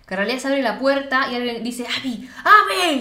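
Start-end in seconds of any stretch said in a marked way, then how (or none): 1.9-3.0 high, loud and slow, calling across a room; urgent call for Abby; shouting a name. Retold or imitated. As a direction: yell the name to find her.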